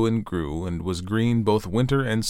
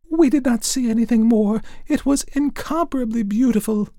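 very high, loud chirpy sparrows; chirping sparrows; 5 dB louder than usual. A narrator's reading. Sparrows tell the fir tree in very high chirps. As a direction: chatter it out like little birds. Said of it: "chirped the sparrows".

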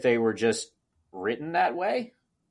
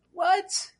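incredulous; 7 semitones higher, 5 dB quieter, slower than usual. Retold or imitated. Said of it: "And I'm like".

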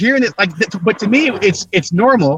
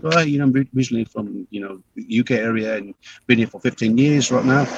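low-key, softer British put-on; British accent; 4 semitones lower, 5 dB quieter than usual. Actor at an audition claiming UK roots, understated British accent. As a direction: slip into a casual, quiet British accent. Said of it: "this, this British accent".

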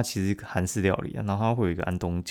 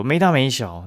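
parent scolding; 5 semitones higher, 7 dB louder than usual. Retold or imitated. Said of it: "他们就说".

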